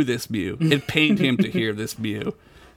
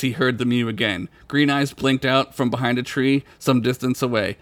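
ordinary delivery

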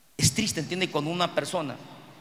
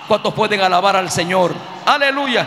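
loud preacher's praise shout; rallying call; 9 dB louder than usual. Real, not acted. Preacher asking the congregation to praise, loud rallying cry.

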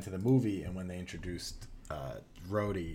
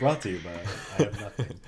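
louder, giggly mock plug; laughing mock sponsor; 5 dB louder than usual. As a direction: joke announcement through laughter.

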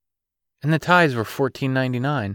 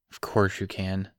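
flat; 2 semitones lower, 7 dB quieter than usual. A narrator's reading. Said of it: "he replied flatly".

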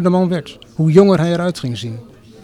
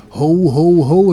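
louder narrated cry; louder, drawn-out ho-ho-ho; calling out; 6 dB louder, much slower than usual. A narrator's reading. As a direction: call out the chant as the drifter. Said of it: "riep de zwerver weer".

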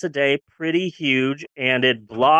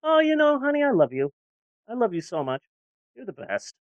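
offhand before hormones; 6 semitones higher, 3 dB quieter, faster than usual. Retold or imitated. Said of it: "before I started taking the hormones".

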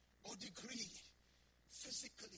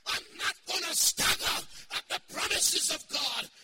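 full-force preaching; 6 semitones higher, 9 dB louder than usual. Acted. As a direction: preach it out with full fire to the congregation.

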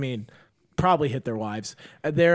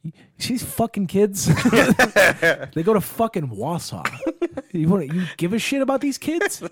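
higher, scornful pep talk; coach trash talk; 6 semitones higher, 4 dB louder than usual. Retold or imitated. Coach talking to his guys about the Wausau kids, scornful. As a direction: sneer at the rival team to rile the players.

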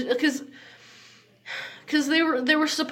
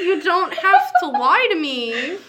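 laughing mock plea; 7 semitones higher, 5 dB louder than usual. Echoing friend's joke, laughing.

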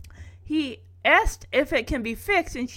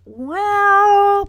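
long, high, loud stalling; hammed-up reluctant hedge; dragged out; 10 semitones higher, 8 dB louder than usual. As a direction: draw the word right out, hesitating. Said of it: "drag out the word".

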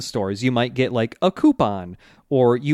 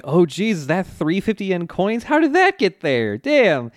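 higher-pitched fake surprise; feigned innocence; 6 semitones higher than usual. Okuyasu caught with coins under his shoe, mock-innocent surprise. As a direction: play badly faked innocence, pitch climbing. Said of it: "Okuyasu's kind of just like".

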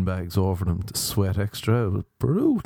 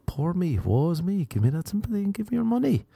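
mock self-pity; 9 semitones higher than usual. Mock plea for sympathy.

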